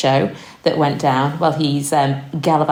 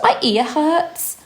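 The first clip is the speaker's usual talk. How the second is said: imitating small child; 9 semitones higher, much slower than usual. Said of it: "she said".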